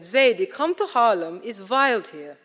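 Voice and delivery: a German friend calling her out to Harlem; strident German voice. Retold or imitated. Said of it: "a strident German voice said".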